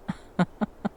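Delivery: a knowing little laugh. Acted. Caught partner enjoying it.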